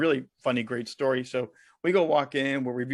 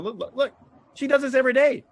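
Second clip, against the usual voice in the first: excited; 10 semitones higher, 5 dB louder, faster than usual. Real, not acted. Excited defence of his attitude.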